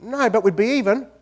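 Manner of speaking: Kiwi comeback. Retold or imitated. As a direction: snap back the winning line. Kiwi's deadpan comeback in joke.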